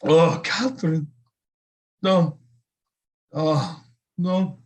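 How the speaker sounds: high, crying out in horror; a seer struck by a terrible vision; oh no, breaking into a cry; horrified cry. Acted. Vision of doom.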